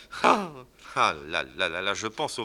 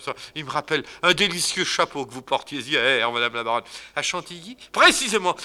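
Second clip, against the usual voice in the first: much higher, louder rapture; gushing flattery; 6 semitones higher, 9 dB louder than usual. Acted. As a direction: swoon over her hair and hat. Fadinard gushing over her hat.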